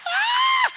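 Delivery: high shriek of laughter; laughing shriek. Real, not acted. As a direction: burst into a loud laughing scream. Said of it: "Ang lakas, sabi niya". (malakas na tiling may tawa)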